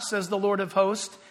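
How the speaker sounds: normal talking voice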